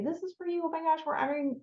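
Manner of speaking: high, soft gushing handover; gushing greeting. Shown as a demonstration. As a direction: coo it to the lady at the desk. Demonstrating greeting at a front desk.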